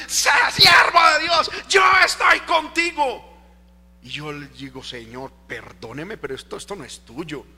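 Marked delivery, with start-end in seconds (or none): 0.1-3.3 high, very loud proclamation; imitated prophet's shouting. Preacher mimics prophets who come yelling God's message, full shout. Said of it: "a gritarme al oído". 4.8-7.5 low, soft, hesitant aside to God; subdued private prayer. Retold or imitated. Preacher retells asking God's pardon, quiet and low.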